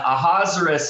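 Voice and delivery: "Ahasuerus" as a-HAZ-er-us, no oo sound. Shown as a demonstration. Teacher picking the easier way, Ahasuerus as a-HAZ-er-us. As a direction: say "Ahasuerus" in four syllables, like Lazarus. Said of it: "is the easier of the two".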